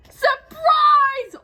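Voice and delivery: high, loud shout of surprise. Acted. Olaf leaping from the gifts, shouting. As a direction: burst out yelling surprise. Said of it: "Olaf shouted".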